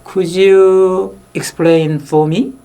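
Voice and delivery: 한 단어씩 늘여 높게; 천천히 또박또박 부탁하듯. Shown as a demonstration. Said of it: "제가 과문해서 그런데". (상대에게 아주 느린 영어로 부탁)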